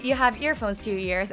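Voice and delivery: ordinary talk, nothing marked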